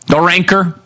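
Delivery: ordinary talk, nothing marked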